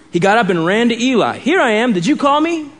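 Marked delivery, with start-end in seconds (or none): 1.4-2.7 put-on higher, eager young voice; eager boy asking his master; lightly voiced boy asking Eli; boy's question. Boy Samuel asks Eli.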